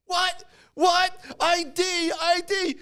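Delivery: very high, squeaky mock indignation; outraged kid. Kid asked for ID by the clerk, high outraged squeal. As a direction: squeal it like an affronted child.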